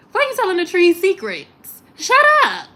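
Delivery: high-pitched outraged comic telling-off; incredulous scolding. Acted. Scolding the girl who talks to trees, incredulous. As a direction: climb to a loud, high shut up.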